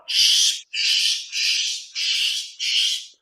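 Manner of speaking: soft voiceless shh bird calls; whispered shh chirps to summon birds; bird call shushing. Retold or imitated. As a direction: shush softly like calling a bird. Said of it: "I just started going".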